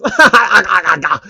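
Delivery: loud, high taunting laugh; smug villain laugh; mocking laugh. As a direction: laugh at the beaten foe. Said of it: "Phil laughs".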